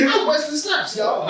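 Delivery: high hammed-up action star voice; Wesley Snipes voice. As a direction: squeak it out in a goofy star voice. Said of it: "Do Wesley Snipes".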